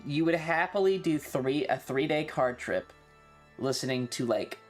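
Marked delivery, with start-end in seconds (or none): none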